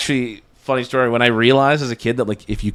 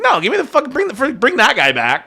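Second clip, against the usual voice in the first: mock demand; 12 semitones higher than usual. Retold demand for manager.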